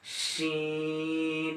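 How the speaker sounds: শিন with a long held sh, drawn out slowly; Arabic letter sheen. Shown as a demonstration. Teacher demonstrating শিন for students, long held hiss. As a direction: say শিন as a slow, sustained Arabic shin. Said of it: "normally আদায় করবেন".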